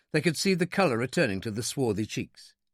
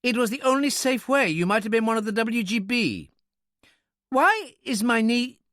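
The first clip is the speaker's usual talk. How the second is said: high, insistent little-man voice; impatient; 10 semitones higher, 3 dB louder than usual. A narrator's reading. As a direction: justify yourself in a high, testy voice. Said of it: "he explained impatiently".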